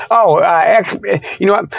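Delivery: ordinary delivery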